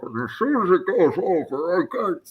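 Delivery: muffled, high-pitched masked villain voice; Bane. Shown as a demonstration. Cosplayer showing how the mask takes over, in Bane's voice. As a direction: slip into a lilting masked-villain impression. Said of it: "like Bane".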